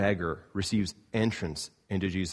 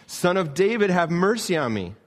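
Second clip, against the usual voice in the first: much higher and louder, pleading; a blind beggar yelling over the crowd; desperate loud cry; 8 semitones higher, 5 dB louder than usual. Retold or imitated. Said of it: "he cried out all the more".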